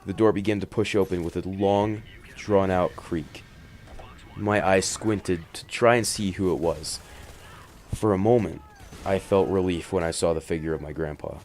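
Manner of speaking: ordinary delivery, nothing marked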